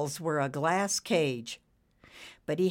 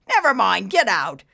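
loud, barked dismissal; angry dismissal; 4 semitones higher, 10 dB louder than usual. A narrator's reading. Boss throwing out the worker, angry dismissal. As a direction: bark him out of the room.

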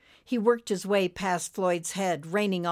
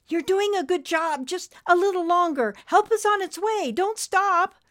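high, loud, urging voice; urgent little helper; 13 semitones higher, 6 dB louder than usual. Acted. Friend urges the trucker to keep up CPR, high and urgent. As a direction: cheer on the rescuer, climbing higher.

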